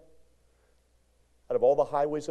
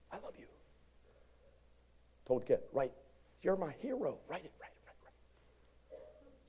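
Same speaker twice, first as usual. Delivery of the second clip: hushed urging; 5 semitones higher, 7 dB quieter than usual. Retold or imitated. Urging kids to write quietly.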